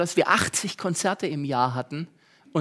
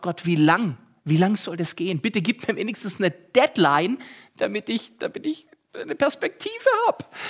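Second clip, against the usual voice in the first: pleading with God; 2 semitones higher than usual. Retold or imitated. Young apprentice begging God, mock desperate. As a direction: beg for a deadline, voice climbing. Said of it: "rief ich zu Gott".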